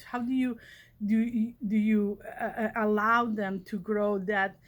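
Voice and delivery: normal talking voice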